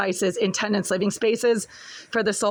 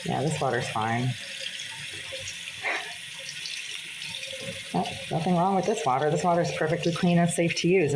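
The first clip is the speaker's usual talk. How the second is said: sarcastic; 2 semitones lower, 4 dB quieter than usual. Real, not acted. Sarcastic claim water is fine.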